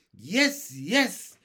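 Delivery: high, loud, eager yeses; chorused. A narrator's reading. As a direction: shout agreement as an excited group would.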